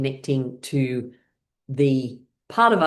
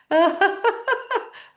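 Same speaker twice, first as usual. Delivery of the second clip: high laugh. Real, not acted. Laughter game.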